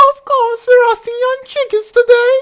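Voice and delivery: very high, loud sarcastic princess; sharp princess. Acted. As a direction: cut him down. Princess retorts.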